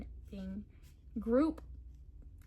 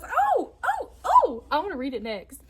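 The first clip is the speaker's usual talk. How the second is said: much higher and louder, eager squeals of oh; excited friend; 8 semitones higher, 11 dB louder than usual. Retold or imitated. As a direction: mimic a friend begging to go next. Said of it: "and she goes".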